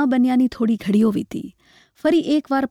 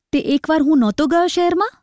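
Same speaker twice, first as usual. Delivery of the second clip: higher-pitched angry retort; indignant; 5 semitones higher than usual. A narrator's reading. Husband sits up and answers his nagging wife, indignant. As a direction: challenge her with an irritated rising question. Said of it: "પુરુષ રોષમાં બેઠો થઈ જતા બોલ્યો".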